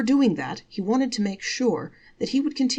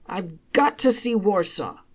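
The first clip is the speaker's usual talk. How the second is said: firm, louder insistence; insisting; 3 semitones higher, 6 dB louder than usual. A narrator's reading. Selig insisting to his wife, firm and louder. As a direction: dig in and insist. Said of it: "Reb Selig insisted".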